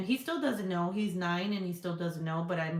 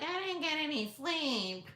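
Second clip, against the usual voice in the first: put-on whiny kid voice; 9 semitones higher than usual. Mocking kids' complaint.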